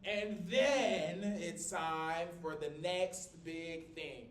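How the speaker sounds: loud, very slow ringing oratory; Martin Luther King. Acted. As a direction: stretch each word out like a rousing preacher. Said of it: "Very M L K coded".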